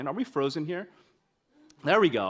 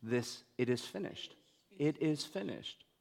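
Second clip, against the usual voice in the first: much softer and lower, a last breath; soft, lower, fading dying words; 4 semitones lower, 10 dB quieter than usual. Retold or imitated. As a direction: hush it like a final exhale on the cross. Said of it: "as he breathes his last".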